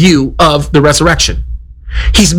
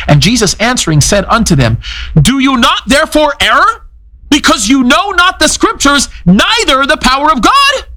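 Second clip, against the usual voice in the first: high, ringing scripture read; preacher proclaiming Jesus' rebuke from the text; 10 semitones higher than usual. Acted. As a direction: proclaim the verse. Reading Jesus' words.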